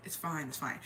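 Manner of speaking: low, soft dismissal; quiet, resigned reassurance in a retelling; retold brush-off. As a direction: wave off the nagging under your voice.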